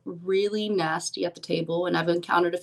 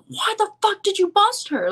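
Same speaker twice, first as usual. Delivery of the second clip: high-pitched mock scream of outrage; hammed-up, squeaky outburst; imitated screaming. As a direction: act out a man yelling in disbelief, pitched way up. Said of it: "starts screaming at the guy".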